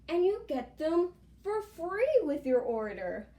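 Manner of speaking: upbeat influencer plug, voice lifted high; sponsor ad read. Real, not acted. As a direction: pitch it to viewers with bouncy emphasis. Delivers sponsor promo to camera.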